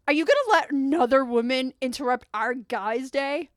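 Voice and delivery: loud, high mock outrage; indignant guy. Guy confronts son about mom's intrusion, loud indignation. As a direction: complain loudly as an affronted buddy. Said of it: "one of the guys jumps up and is like".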